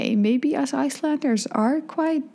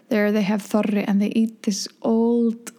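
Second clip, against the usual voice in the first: usual speaking voice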